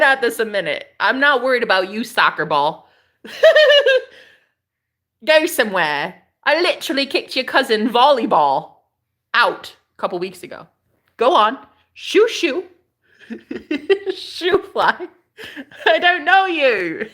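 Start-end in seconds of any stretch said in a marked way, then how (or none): 3.2-4.0 sudden shrieking high laugh; high cackle. Real, not acted. Cackling after teasing a viewer. 11.2-17.1 mock shooing. Shooing a viewer away playfully.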